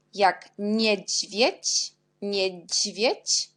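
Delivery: teacher breaking down niedźwiedź for learners; very slow demo. Shown as a demonstration. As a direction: spell out niedźwiedź at a crawl. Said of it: "on prononce dzi".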